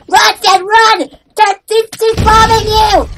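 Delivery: high, urgent mock-panic shouting; panicked warning. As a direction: yell to make a friend run. Warning Stan of bombing in game.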